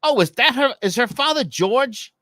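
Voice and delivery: high, excited Jersey mom voice; mother's eager Jersey-accented question; mom's Jersey accent. Retold or imitated. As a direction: imitate a Jersey mother asking. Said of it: "my mom has a Jersey accent".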